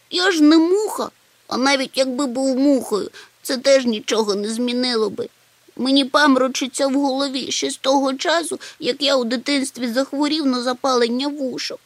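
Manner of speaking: high, whiny self-pity; tearful whining. A narrator's reading. Chmykh on why he cannot climb out, tearful. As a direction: complain in a high, tearful little voice. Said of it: "Чмих знову заплакав".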